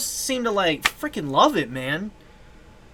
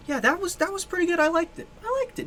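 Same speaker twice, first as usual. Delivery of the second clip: excited; 10 semitones higher, 4 dB quieter, faster than usual. Real, not acted. Excited reaction to track, high pitch.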